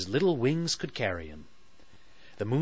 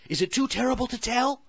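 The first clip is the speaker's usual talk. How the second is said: anxious Sally; 13 semitones higher, much faster than usual. Acted. Sally voiced by narrator.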